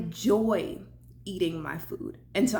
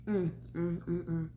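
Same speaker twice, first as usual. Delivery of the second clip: appreciative mm while chewing; 3 semitones lower, 6 dB quieter than usual. Real, not acted. Tasting food in mukbang.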